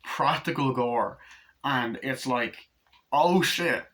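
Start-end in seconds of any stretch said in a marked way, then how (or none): 3.1-3.8 raised, startled curse; mock shock. Viewer reacting to the gore, mock shock. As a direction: react as if gore just splattered.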